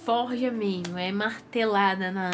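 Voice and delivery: ordinary delivery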